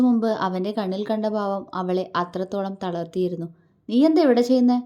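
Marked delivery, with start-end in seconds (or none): 3.9-4.8 loud, higher-pitched challenge; displeased demand. A narrator's reading. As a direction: demand an explanation crossly. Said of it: "മുഖം ചുളിച്ചുകൊണ്ട് ചോദിച്ചു". (അനിഷ്ടത്തോടെ ഉച്ചത്തിലുള്ള ചോദ്യം)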